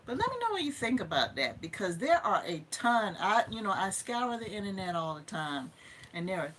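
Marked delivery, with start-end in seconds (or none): none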